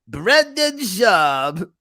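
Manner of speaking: put-on slow, drawn-out child's "Brendan Schaub." with a rising end; imitating a little kid stretching out "Brendan Schaub."; five-year-old saying it. Dad imitating his five-year-old saying the name, slow and drawn out. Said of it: "My five-year-old always does that".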